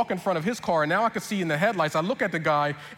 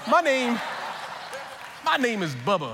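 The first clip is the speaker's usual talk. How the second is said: put-on Southern accent; 6 semitones higher than usual. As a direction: introduce yourself as a proud Southern Bubba. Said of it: "stick with this accent".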